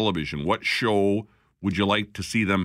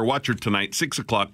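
ordinary talk, nothing marked

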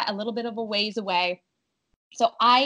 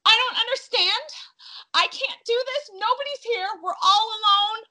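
whimpery; 11 semitones higher than usual. Retold or imitated. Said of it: "a little bit whimpery".

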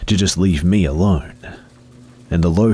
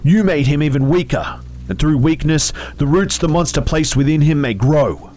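accusing phantom; 10 semitones higher than usual. Acted. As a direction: pile on the blame. Phantom blames narrator.

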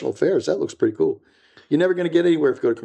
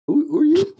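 much higher and louder, a disbelieving yelp; incredulous; 4 dB louder than usual. Retold or imitated. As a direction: toss it out in mock outrage. Incredulous reply to young mentor.

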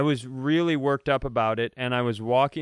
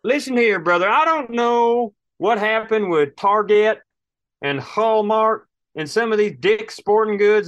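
louder, higher blustering rant; ranting at a store that stopped selling guns, loud and high; tough-guy rant; 6 semitones higher, 3 dB louder than usual. Acted.

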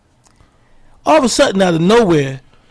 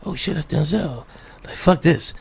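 soft hushed inner voice; whispered to himself in awe; soft starstruck whisper; 3 semitones lower, 6 dB quieter than usual. Retold or imitated. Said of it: "But I was like".

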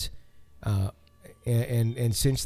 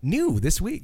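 TV announcer voice; 8 semitones higher, slower than usual. Retold or imitated. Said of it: "And it's like".

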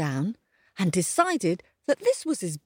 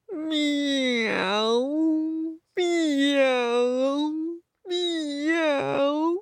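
high, long-drawn mournful cat meow; sad meowing; 8 semitones higher, much slower than usual. A narrator's reading. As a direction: imitate a cat feeling sorry for itself. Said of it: "that very sad meow".

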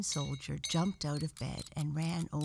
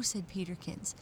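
ordinary delivery, nothing marked

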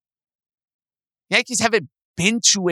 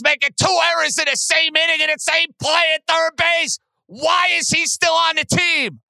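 real furious radio rant; angry shout; 10 semitones higher than usual. Real, not acted. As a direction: yell it, fed up with the team. Rant about Donaldson's errors.

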